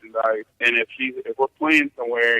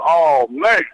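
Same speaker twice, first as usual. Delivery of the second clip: yelled catchphrase; 4 semitones higher, 5 dB louder than usual. Retold or imitated. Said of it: "I always yell out".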